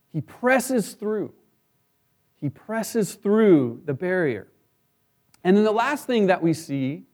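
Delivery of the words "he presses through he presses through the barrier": slow emphasis. Real, not acted. Emphatic sermon point.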